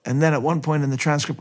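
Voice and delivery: ordinary talk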